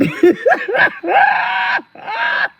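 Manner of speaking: high, squealing burst of laughter; helpless high cackle; loud cackling laugh. Real, not acted. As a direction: crack up loudly. Cracks up at the quote.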